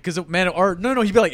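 ordinary talk

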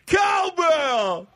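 high, loud shout; fan yelling cowbell. Retold or imitated. Imagined fan interrupting dinner, loud high shout. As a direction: bellow it across a restaurant. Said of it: "you're having dinner and you're like".

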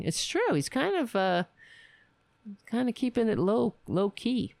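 ordinary talk, nothing marked

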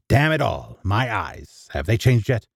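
usual speaking voice

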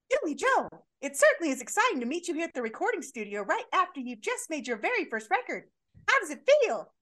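high, excited interviewer mom voice; high, gushing mom voice; Billy Joe's mom. Acted. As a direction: gush at him like a proud mother. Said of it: "Billy Joe's mom at the beginning".

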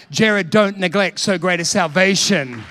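loud, slow, emphatic admonition; forceful warning. Retold or imitated. Preacher driving home the warning, loud and slow. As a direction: hammer out "don't neglect" word by word.

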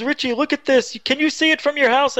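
high, urgent imitation of dad; acted excitement, pitched well up; excited urging. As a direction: urge him to look right now. Dad urging son in retold frantic call.

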